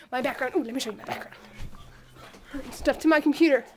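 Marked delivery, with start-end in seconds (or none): 2.7-3.6 excited. Real, not acted. Excitedly shows background photo.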